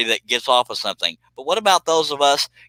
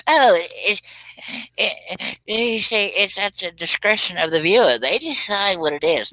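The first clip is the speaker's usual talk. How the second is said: 1 mock art snob; 6 semitones higher, slower than usual. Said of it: "mocking art crowd".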